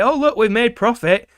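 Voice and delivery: mock smug boss voice; mocking bosses. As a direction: sneer it like a smug executive. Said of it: "fire them and say".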